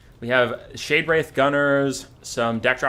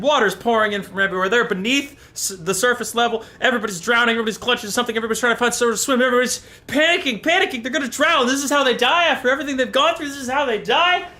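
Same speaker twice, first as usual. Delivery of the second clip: high, fast, breathless storytelling; frantic narration; 10 semitones higher, 3 dB louder, faster than usual. A narrator's reading. Streamer narrates the crew drowning, high and rushed. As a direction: race through the panic.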